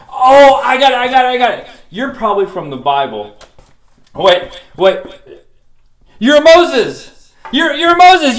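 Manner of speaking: loud, high cries of recognition; excited. Acted. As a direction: shout it like you just figured it out. Recognises Moses, thrilled.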